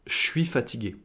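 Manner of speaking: soft "chui fatigué"; je suis as chui. Shown as a demonstration. Teacher stressing the sound, "je suis" said "chui". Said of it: "c'est le son ch".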